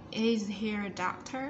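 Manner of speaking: slow, drop at the start then rise on "doctor?"; rising question tune. Shown as a demonstration. Teacher showing the class question intonation, slow rising tune. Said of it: "xuống giọng đầu câu và lên giọng cuối câu".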